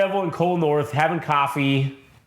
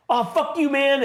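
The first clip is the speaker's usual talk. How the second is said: mock bystanders; 12 semitones higher than usual. Crowd reacting.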